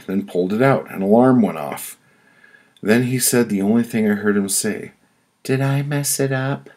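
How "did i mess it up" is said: lightly voiced old man, higher and timid; a hint of a confused old customer asking; meek old man. Old whistler asks the clerk after the card alarm, lightly voiced and timid.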